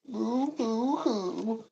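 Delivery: high, slow fake sobbing boo hoo; drawn-out pretend boo hoo hoo; mock boo hoo. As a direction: ham up a babyish sob. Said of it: "making me cry".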